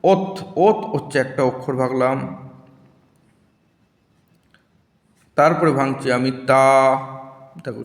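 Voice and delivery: ওত... তা... বস... শক with long pauses; slow syllables for the class; slow syllable split. Shown as a demonstration. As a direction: break অত্যাবশ্যক slowly. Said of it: "slow উচ্চারণ করে দেখবে".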